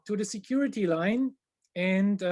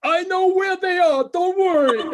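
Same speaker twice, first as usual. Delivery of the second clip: much higher, louder, breezy driver voice; hammed-up cocky driver; 11 semitones higher, 10 dB louder than usual. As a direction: play the speeding driver brushing off worry. Driver reassuring while speeding.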